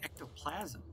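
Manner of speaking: Ectoplasm with the stress on the wrong syllable, PLASM; misplaced stress. Real, not acted. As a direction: lean on PLASM in Ectoplasm instead of the first syllable. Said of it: "put the emphasis on the wrong syllable".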